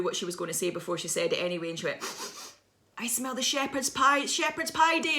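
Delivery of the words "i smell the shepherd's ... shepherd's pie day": acted dread. Friend's dreadful announcement, acted.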